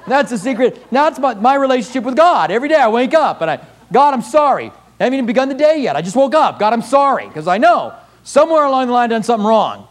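a touch of a humble penitent; voicing a praying man. Preacher voicing a man's confession.

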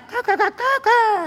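high-pitched mocking rooster crow; heckler's mock cock-a-doodle crow; imitated rooster crow. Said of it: "make the sound of a rooster".

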